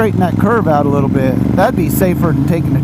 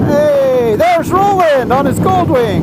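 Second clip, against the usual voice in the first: excited; 10 semitones higher, slower than usual. Real, not acted. Spots a friend on the road.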